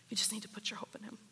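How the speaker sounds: rushed, breathy whisper of encouragement; quick whisper. Acted. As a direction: hurry a whispered reassurance. Comforting someone through a trial.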